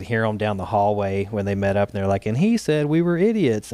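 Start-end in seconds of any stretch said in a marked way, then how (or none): 2.3-3.7 high, louder whiny mock indignation; mock outraged gossip. Staffers telling their group about the insult, mock indignant. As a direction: ham up their offended complaint.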